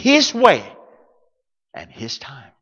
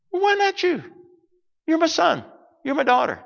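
fatherly God reply; 9 semitones higher, 8 dB quieter than usual. Retold or imitated.

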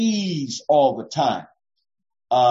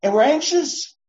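ordinary talk, nothing marked

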